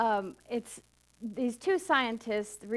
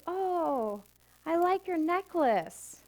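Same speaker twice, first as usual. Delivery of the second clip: lightly acted admiring woman, higher pitch; a hint of a delighted stranger, pitched up; admiring passer-by; 6 semitones higher, much slower than usual. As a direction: coo a compliment as a passer-by would.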